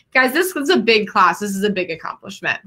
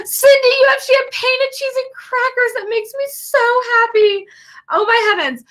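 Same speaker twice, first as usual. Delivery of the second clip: much higher and louder, near-crying with joy; delighted squeal; 3 dB louder than usual. Real, not acted. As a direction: gush over it, overwhelmed with happiness. Delighted at a graduate's champagne and cheese.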